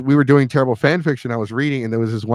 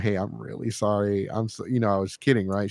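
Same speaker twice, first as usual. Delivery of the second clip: quiet sincere apology; 3 semitones lower, 8 dB quieter than usual. Retold or imitated. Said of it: "I'm like".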